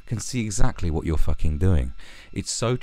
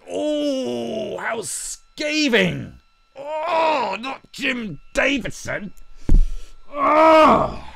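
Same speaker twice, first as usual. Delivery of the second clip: very high, drawn-out wail; hammed-up scandalised falsetto; mock horror; much slower than usual. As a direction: gasp it out in sarcastic anguish. Mocks the review's supposed cutting insult.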